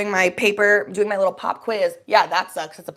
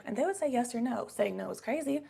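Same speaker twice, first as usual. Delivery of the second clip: normal talking voice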